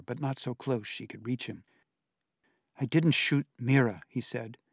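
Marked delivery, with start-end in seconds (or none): none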